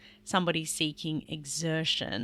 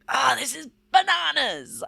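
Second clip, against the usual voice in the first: belting, silly; 9 semitones higher, 8 dB louder than usual. Shown as a demonstration. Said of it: "So belting out something like".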